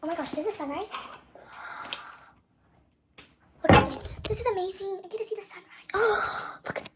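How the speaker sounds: high, loud awe at the sunrise; thrilled, gasping at the view; excited. Real, not acted. Sees the sunrise.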